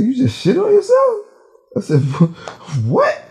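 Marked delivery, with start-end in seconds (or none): 0.0-1.3 high-pitched, louder outraged disbelief; mock-furious squawk of disbelief; dead mad. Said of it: "You was dead mad". 2.0-3.2 high, outraged stammer; mock-furious, high-pitched disbelief; dead mad. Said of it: "You was dead mad".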